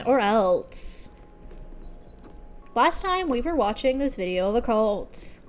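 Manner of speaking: hammed-up YouTuber intro with a loud opening; generic YouTuber. Shown as a demonstration. Streamer parodying a channel welcome, generic YouTuber. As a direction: open big, then swoop through it like a vlogger. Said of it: "I can do generic YouTuber".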